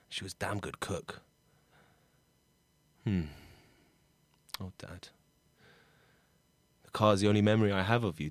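soft, low musing with long pauses; subdued, missing his dad; wistful talk to absent dad. Acted. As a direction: speak privately to a father who is gone. Character addresses absent dad, alone.

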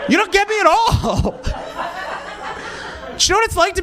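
high, laughing whine of a wounded artist; laughing mock artist. Mocking himself as the damaged artist to the crowd, laughing. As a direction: ham up the tortured artist through laughter.

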